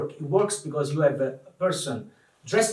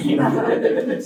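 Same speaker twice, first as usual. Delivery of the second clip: laughing it out, high and loud; 9 semitones higher, 7 dB louder than usual. Real, not acted. To the audience after the Disney joke, laughing loudly. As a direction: break into a real laugh on the question.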